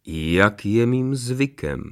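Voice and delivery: very slow, stately gallantry; grand courtly reply. A narrator's reading. Giant bows and answers the princess, stately. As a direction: stretch every word with ceremonious poise.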